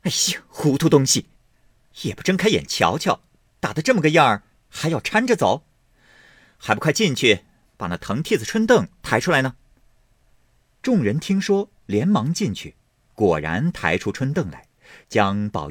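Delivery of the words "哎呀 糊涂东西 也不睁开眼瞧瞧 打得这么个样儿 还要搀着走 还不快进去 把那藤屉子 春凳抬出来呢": high, sharp impatient scolding; scolding servants. A narrator's reading. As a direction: tell the fools off briskly. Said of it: "凤姐便骂道".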